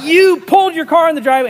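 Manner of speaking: high, loud retold accusation; losing it. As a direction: reenact an angry rant at a neighbor. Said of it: "I lost it".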